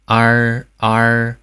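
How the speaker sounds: 啊 with the tongue curled back; wrong erhua. Shown as a demonstration. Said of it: "错误发音". (错误的卷舌儿化音)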